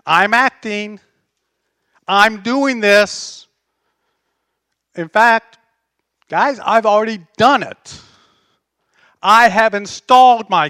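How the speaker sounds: loud, slow and proclaiming; booming voice of God. Acted. Preacher voicing God to the nations, booming. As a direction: declare ownership to the nations.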